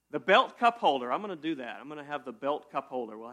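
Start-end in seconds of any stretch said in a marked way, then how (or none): 0.1-1.1 louder, punchy product announcement; mock sales pitch. Pitching a silly product idea.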